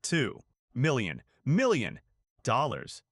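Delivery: slow, word by word with pauses; drawn-out words, each left to sink in; very slow repeat. Shown as a demonstration. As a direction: spell out the amount slowly for impact. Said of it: "Let me repeat that very slowly".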